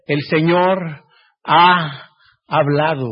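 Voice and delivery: slow, falling, final words; slow solemn close. Acted. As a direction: let the verdict land slowly. Closing a scripture reading.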